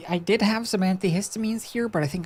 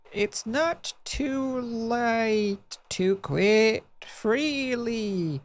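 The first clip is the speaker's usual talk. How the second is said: ordinary delivery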